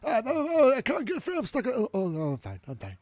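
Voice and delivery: high panicky cartoon voice; Sancho stuck in a passageway. Retelling the scene, voicing Sancho's high panic. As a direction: squeal like he's stuck. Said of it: "That's a good delivery there".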